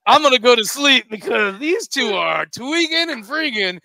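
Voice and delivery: booming start, then slow and drawn out; big hammed-up declaration to a stranger; loud mock announcement. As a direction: announce it loudly, stretching the words. Acted announcement to attendant in story.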